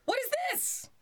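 very high, startled question; shocked outcry. A narrator's reading. Drakestail finds himself pushed into the coop, shocked. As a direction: shoot the pitch right up in alarm. Duck shoved among attacking birds.

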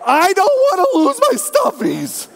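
put-on high, slow whiny little girl wail; mock toddler tantrum. Daughter wailing at dad she won't share, high and slow. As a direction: whine it like a teary three-year-old. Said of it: "welled up with tears".

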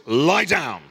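loud firm order, like to a dog; stern dog command. Retold or imitated. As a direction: bark it out as to a dog. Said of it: "the way I talk to my dog".

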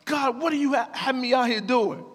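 high-pitched, exasperated plea to God; indignant outburst aimed upward; angry complaint. Retold or imitated. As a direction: retell an angry rant, voice pushed up high. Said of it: "I'm so angry".

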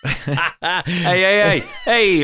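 hammed-up Italian mobster calling out; angry Italian mobster. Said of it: "like she's some angry Italian mobster".

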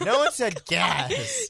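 high, slow, drawn-out disbelief; mock outrage. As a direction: stretch it out in fake shock. Mock outrage that nobody said gas.

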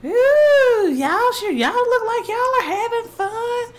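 high, sing-out imitation of a woman; drawn-out, high mock busybody; nosy woman. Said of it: "This woman was like".

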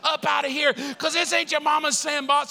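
high, hammed-up scolding; chief yelling. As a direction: chew out the recruit as the chief. Chief explodes at recruit.

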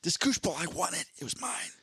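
hoch, kindlich begeistert, am Ende geflüstert; aufgeregt. Pretend. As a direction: ein Kind, das den Koosh-Ball unbedingt will. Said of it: "You're like".

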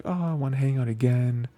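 a happy girl's lightly voiced excitement; giddy delight. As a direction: bubble over like an eager friend. Retelling her happy invitation.

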